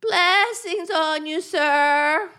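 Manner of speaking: quavering, goat-like thanks; drawn-out bleat, softening as she moves off; bleating old beggar. Pretend. Old violet seller blessing the gentleman who paid, bleating and fading. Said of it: "you sound like a goat".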